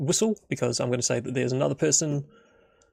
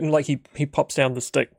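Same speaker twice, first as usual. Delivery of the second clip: normal talking voice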